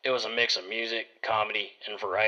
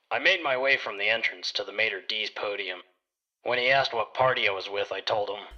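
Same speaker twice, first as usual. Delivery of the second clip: ordinary delivery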